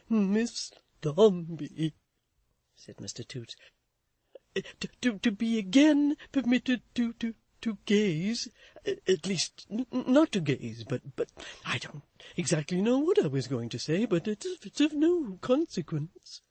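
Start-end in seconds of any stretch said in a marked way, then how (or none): 0.1-1.9 slow, loud, bashful address; nervous young gentleman; awkward suitor. A narrator's reading. Toots nervously greeting Florence, slow and awkward. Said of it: "stammering exceedingly". 4.5-16.4 nervous stumbling stammer. A narrator's reading. Toots stammering his thanks to Florence. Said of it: "stammering exceedingly".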